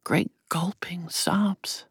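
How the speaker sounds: slow breathy whisper. A narrator's reading. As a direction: hush it, slowly. Narration whispered after crying.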